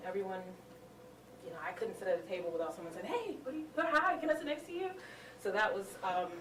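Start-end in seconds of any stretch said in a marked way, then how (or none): 3.1-4.9 eager greeting. Retold or imitated. Friendly stranger greeting at conference.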